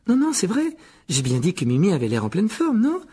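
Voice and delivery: put-on high, loud, hasty girl protesting; Hermione insistante. A narrator's reading. Hermione insiste pour rassurer Mimi. (voix aiguë qui proteste)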